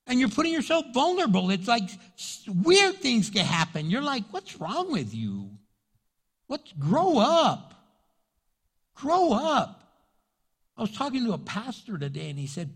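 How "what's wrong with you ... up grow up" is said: scolding. Retold or imitated. Scolding immature friends.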